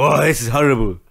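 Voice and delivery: comic retelling of his dawning alarm; mock alarmed Gascoigne. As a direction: play the panic big, laughing at the end. Quoted Gascoigne, higher louder, laughing.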